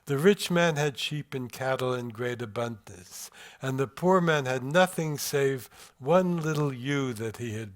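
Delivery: a lightly sing-song parable rhythm, gently rising and falling; sing-song reading. Shown as a demonstration. Translator reading David's parable aloud, lightly sing-song. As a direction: read it like an old parable, in a light lilting beat. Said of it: "you might have heard in my reading out loud a kind of sing-song rhythm".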